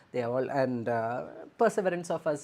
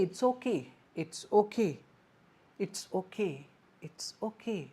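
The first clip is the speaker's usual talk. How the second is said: reassuring; 4 semitones higher than usual. Shown as a demonstration. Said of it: "And you can say".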